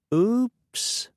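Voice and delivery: high sing-song fake apology; sweetly insincere little oops. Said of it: "in a sing-song voice that sounded warmly insincere".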